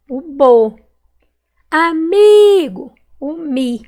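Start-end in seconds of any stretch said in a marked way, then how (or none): 1.7-2.9 "Amigo" slow and loud, high long MI; stretched stressed syllable. Shown as a demonstration. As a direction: call out "Amigo", holding MI. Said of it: "Qual que eu demorei mais falar".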